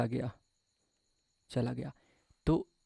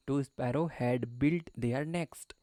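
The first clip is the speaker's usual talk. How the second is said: slow reading; much slower than usual. Shown as a demonstration. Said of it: "Their मतलब क्या होता है भाई?".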